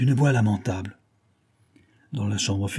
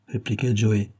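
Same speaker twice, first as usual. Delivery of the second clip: plain reading voice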